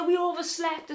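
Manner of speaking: high-pitched retold alarm; panicked urgency. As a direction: break the bad news in a rush of panic. Panicking after oversleeping.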